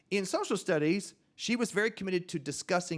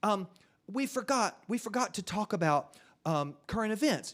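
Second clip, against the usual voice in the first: higher-pitched schoolchild begging for teacher's attention; hesitant, higher child's voice, stalling; eager pupil; 5 semitones higher than usual. Acted. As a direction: pipe up like a kid with a hand raised. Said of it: "shoot their hand up and say".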